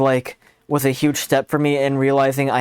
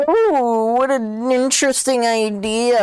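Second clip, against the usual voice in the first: drawn-out mock delight, higher; sarcastic; 9 semitones higher, slower than usual. Gamer mocking friend's advice, slow sarcastic delight. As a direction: fake wonder, slow.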